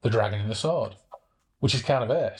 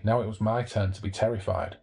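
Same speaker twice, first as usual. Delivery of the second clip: ordinary delivery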